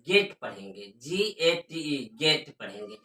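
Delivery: गेट with a plain e, not ae; गेट, softly corrected for the class; correct pronunciation. Shown as a demonstration. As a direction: say गेट as gate-like get, low and soft. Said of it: "गैट नहीं पढ़ेंगे".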